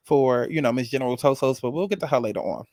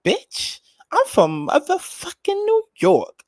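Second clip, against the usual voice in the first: high-pitched, drawn-out New York brag; Cleo Trapper meme impression; 13 semitones higher, 3 dB louder, much slower than usual. Quoting a favorite influencer's meme, high-pitched impression. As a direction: imitate a viral meme way up high. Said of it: "so many of her memes saved".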